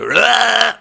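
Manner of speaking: loud high retching bark; imitated retching roar. Retelling his vomiting in the toilet, loud imitated retch. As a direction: heave it out like throwing up. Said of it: "violently projectile vomiting".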